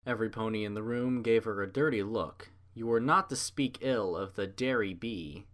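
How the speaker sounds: usual speaking voice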